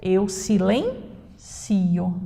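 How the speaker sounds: very slow "silencio", stress on CI. Shown as a demonstration. Teacher to class, slowly models "silencio" as a verb. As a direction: draw out "silencio", stress on the third syllable. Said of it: "Sem acento é o verbo".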